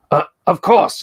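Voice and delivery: polite librarian voice. Acted. NPC librarian replies.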